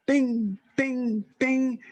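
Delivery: high pinging imitation of a shuttlecock; ping it out high like a shuttlecock being struck; a high, bright bing of a badminton shuttle heard through the window; imitated shuttlecock. Imitating shuttlecock sound on waking.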